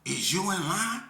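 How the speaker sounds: high, loud mocking woman's voice; a hint of a rude stranger challenging him; woman's challenge. Woman in line challenging the speaker, high and loud. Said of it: "She gonna say".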